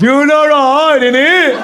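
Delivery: high, loud, drawn-out mock British; Peaky Blinders accent. American mimics the British, manic Peaky Blinders accent. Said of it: "Peaky Blinders on meth".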